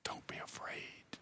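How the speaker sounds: barely audible, tender whisper; whispered reassurance. Retold or imitated. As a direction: whisper it to soothe a frightened friend.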